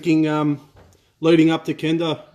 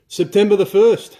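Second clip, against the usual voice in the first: excited announcement; 6 semitones higher, 7 dB louder than usual. Real, not acted. Hyping the upcoming event date.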